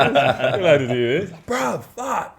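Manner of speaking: laughing, high mock outrage; mock protest. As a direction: squeal it in fake indignation.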